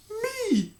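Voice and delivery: high, sharp disbelieving cry; incredulous demand. A narrator's reading. Queen hit by an accusation, disbelieving. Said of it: "she demanded".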